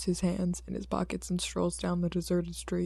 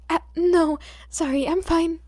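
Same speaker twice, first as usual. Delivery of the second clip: higher, startled answer; flinching reply; 9 semitones higher, 6 dB louder than usual. A narrator's reading. The boy flinches and answers the hero, high and startled. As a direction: blurt it out startled. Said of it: "The boy flinches".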